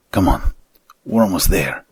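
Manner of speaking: loud, pressing urge onward; urgent call. Acted. Hastings to his partner on the rooftop, urgent call.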